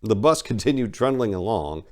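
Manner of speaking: plain reading voice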